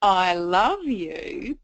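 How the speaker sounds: "I love you" very slow and drawn out with a rise; lingering hint. Retold or imitated. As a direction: stretch out "I love you" invitingly. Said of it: "they'll pause for longer on that one".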